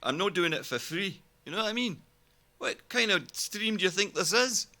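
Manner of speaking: playful hint of a Scotsman refusing to work for free; mock Scottish accent. Streamer teases chat about paying for the Scots voice, lightly Scottish. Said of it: "talk like a Scotsman".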